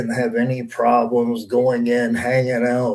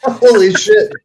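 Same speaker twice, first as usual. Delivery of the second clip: high, loud disbelieving burst; surprised; 13 semitones higher, 7 dB louder than usual. Real, not acted. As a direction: blurt it out in shock. Reacting to absurd show.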